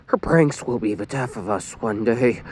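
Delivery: gloomy goth monotone; grim. A narrator's reading. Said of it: "Lucy said grimly".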